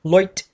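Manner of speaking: "लोइट" with an oi vowel for light; English-accent pronunciation. Shown as a demonstration. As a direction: say "लोइट" like a put-on British light. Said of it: "इसी तरह लाइट हो जाए तो".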